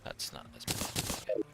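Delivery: unvoiced whisper. Real, not acted. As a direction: breathe it out. Whispered aside.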